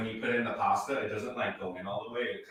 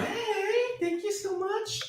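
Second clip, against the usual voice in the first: excited; slower than usual. Real, not acted. Thanking a viewer for super chat.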